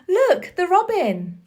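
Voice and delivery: high, loud glad cry; a boy spotting the robin, calling out brightly; excited call. Acted. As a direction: point it out with delight. Dickon spots robin, relieved.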